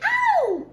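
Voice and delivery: high, loud yelp of pain; sudden pained cry; scream of pain. A narrator's reading. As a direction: shriek as if bitten on the toe. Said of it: "I let out a scream".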